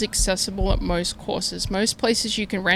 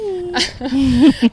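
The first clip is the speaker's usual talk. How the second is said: loud laugh; 6 semitones higher, 6 dB louder than usual. Real, not acted. Laughs at friend's admission.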